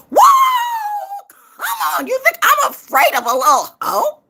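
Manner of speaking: very high, loud, mocking cry; mocking shout. Real, not acted. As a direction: yelp it out, taunting.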